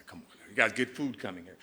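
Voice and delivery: ordinary delivery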